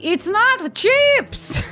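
high, drawn-out mock shout; loud shout. Player jokingly yelling to the party, loud shout. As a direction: stretch it out like a jokey holler. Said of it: "I shout loudly".

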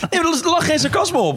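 laughing protest. Real, not acted. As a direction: protest through a laugh, way up high.

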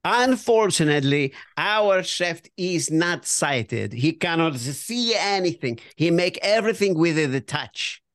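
put-on measured Italian waiter accent. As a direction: turn down the guest in an Italian accent. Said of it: "Oh, now I'm gonna do Italian".

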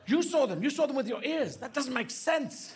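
high, emphatic mock disbelief; incredulous dog owner. Owner back with a gun, high incredulity. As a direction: challenge the dog, slowing to a finish. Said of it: "Then the owner comes back".

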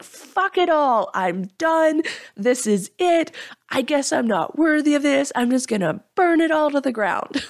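mock mad outburst. Jen replaying her old self quitting in a fit, mock angry outburst. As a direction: ham up a melodramatic meltdown, throwing it all away. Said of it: "I would get so mad".

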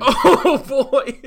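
loud, high whoop of laughter; laughing outburst. Real, not acted. Co-host reacting to the too-bright bedroom, laughing hard. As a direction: burst out laughing on the words. Bursting out at the bright-room question.

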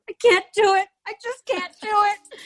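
high, whiny mock sob; a meme caption of a crying Rinna, mock wail. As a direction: wail it like a hammed-up weepy housewife. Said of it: "Rinna going".

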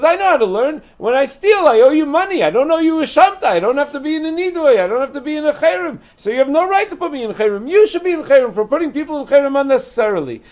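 high, loud, brazen ranting; cheeky thief. Retold or imitated. Thief lecturing Rish Lakish on the law, high loud brazen rant. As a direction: lecture him like a smug know-it-all. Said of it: "He says".

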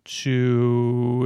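ordinary delivery

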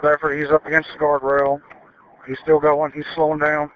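usual speaking voice

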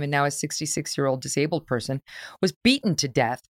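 ordinary talk, nothing marked

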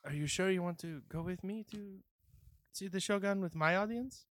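high, timid little voice; nervous NPC. Acted.

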